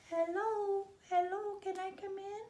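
high, small birdlike voice; a tiny high chirping baby bird; little bird voice. Acted.

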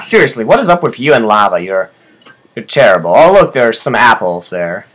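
usual speaking voice

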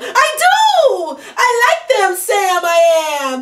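high, loud delighted cry; thrilled, laughing exclamation; joyful shout. Acted. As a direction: burst out with glee at the discovery. Joyful discovery he likes them.

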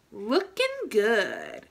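slow, drawn-out "Looking good"; slow proud self-praise; slow cheer. Shown as a demonstration. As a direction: savor each word of "Looking good". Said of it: "Look at yourself in the mirror and say".

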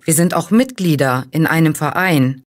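usual speaking voice

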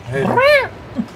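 slow, squeaky high-pitched complaint; high squeal. Real, not acted. Whining to his friend about the creepy monsters in a high slow squeal. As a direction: squeal out the dislike.

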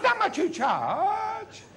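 very high, slow comic haggling question. Acted.

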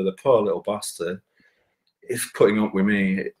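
ordinary delivery

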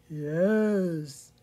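low, loud cow voice; storybook cow, deep moo. A narrator's reading. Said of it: "she mooed".

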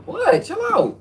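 very high squeaky shriek; mock squeal. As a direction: squeal it like a startled girl. Friend squeals back at him.

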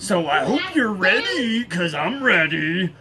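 rally the troops for training; imitating deployment NCO. NCO rallying everybody for P T, loud imitation. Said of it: "he used to say".

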